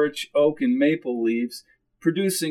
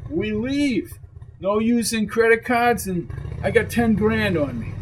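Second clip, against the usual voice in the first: higher-pitched tough guy pressing to leave; insistent, raised-pitch character voice; tough biker uncle, urgent; 6 semitones higher than usual. Acted. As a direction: voice a wary biker urging escape. Voices Dulio giving the plan.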